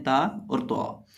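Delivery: ता thin, then तो heavy, said slowly; thin versus heavy. Shown as a demonstration. Teacher contrasting two letters, slow ता and तो. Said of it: "जो ता है इसको बारीक पढ़ते हैं".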